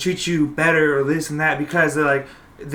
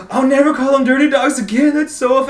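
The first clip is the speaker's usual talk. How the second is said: high, aghast mock outrage; mock offended girlfriend; 10 semitones higher, 5 dB louder than usual. Woke girlfriend reacting to the dirty dog trick, high mock horror. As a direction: gasp it out high, scandalized and hammed-up.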